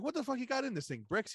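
high-pitched mock complaint from an Italian guy. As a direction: act out an incredulous Italian character. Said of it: "The, the Italian guy".

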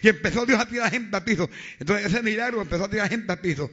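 loud fiery sermon, shouting the faith; preaching at full force. Acted. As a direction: proclaim it at full voice to the congregation.

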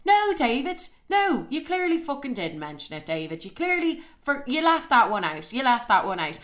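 high-pitched indignant telling-off; raging. Retold or imitated. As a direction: catch him out in his lie, exasperated. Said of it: "obviously you could tell I was raging".

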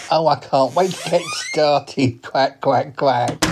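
slow, drawn-out duck voice; eager duck. Acted. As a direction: talk like an eager cartoon duck. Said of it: "he is a duck".

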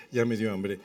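ordinary talk, nothing marked

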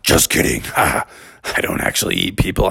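low voice easing into joking; cave voice. Acted. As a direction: drop it low, then loosen up. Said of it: "And the voice said".

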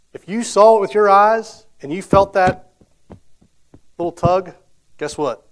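loud, building preacher's call, slowing on the big lines; preaching at full force. Acted. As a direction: drive it home like a revival preacher. Preaching the need, rising.

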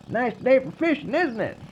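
high-pitched friendly holler across water; calling out. Acted. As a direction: call it high to a passing boat. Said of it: "Somebody calls out".

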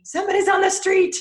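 high, loud alert like a barking dog; alarmed dog voice. Retold or imitated. Guest voicing her dog Finley alerting her, high and loud. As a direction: sound the alarm for the owner. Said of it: "she is wired to alert me".

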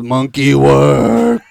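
loud, slow, dumb brute voice; mock Bane bellow. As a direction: grunt it out like a lumbering henchman. Quoting Bane.

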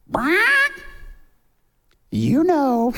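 high squawk, then a sly parrot voice; squawk, then tease like a bird. Acted. Parrot eyes the man walking by, squawking knowingly. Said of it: "the parrot goes".

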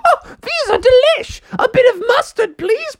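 very high falsetto, delighted eater; Alice. Acted. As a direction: squeal with greedy delight in falsetto. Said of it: "ten at a time and going".

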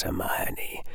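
Hermione breathed as a soft whisper; whispered name. A narrator's reading. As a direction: whisper her name from the dark. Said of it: "spoke the sibilant whisper".

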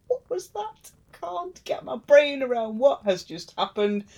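high, bewildered gasp of a question; stunned disbelief. Retold or imitated. Hymir reeling after rowing Thor ashore, high stunned disbelief. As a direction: sound like your brain just short-circuited.

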